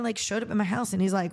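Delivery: normal talking voice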